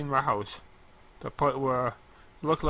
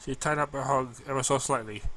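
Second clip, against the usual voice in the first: usual speaking voice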